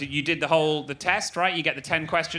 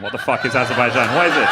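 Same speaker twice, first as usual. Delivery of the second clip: loud baffled rant; exasperated outburst; 6 dB louder than usual. Acted. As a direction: blurt it out in exasperation. Mock ignorant outburst.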